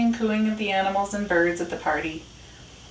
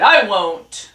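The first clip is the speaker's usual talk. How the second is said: loud, curt retort; shouting; 3 semitones higher, 8 dB louder than usual. A narrator's reading. Dog answers Rat across the junkyard, shouting. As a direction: shout it back stubbornly. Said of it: "Dog shouted".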